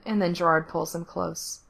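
plain reading voice

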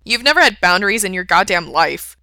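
loud, exasperated retort; calling out. A narrator's reading. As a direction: call it up to someone in the front seat. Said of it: "Frank calls up at him".

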